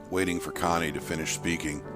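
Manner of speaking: plain reading voice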